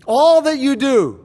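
All that you do with All lifted high and loud; stress on all. Shown as a demonstration. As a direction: lean hard on the word All. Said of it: "the word all comes first for emphasis".

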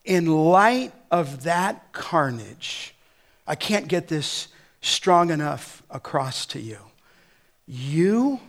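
ordinary delivery

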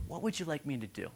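very soft, quick, tender question; gentle offer. Acted. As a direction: drop to a hushed, kindly ask. Jesus kindly addressing Bartimaeus.

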